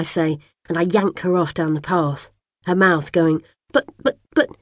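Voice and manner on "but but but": high popping "but, but, but"; bubbly stammer. A narrator's reading. Little sister sputtering as she is dragged away, high and popping. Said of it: "sounds like bubbles bursting".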